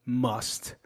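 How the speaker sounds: normal talking voice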